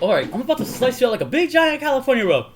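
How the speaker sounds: high, loud comic bragging; mock tough threat. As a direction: threaten in a hammed-up movie voice. Acted threat in movie fight.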